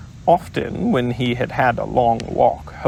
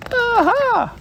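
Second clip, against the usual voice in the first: much higher, forced aha; weak Piglet aha; 4 dB louder than usual. Acted. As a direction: squeak out a brave but feeble aha. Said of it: "as well as he could".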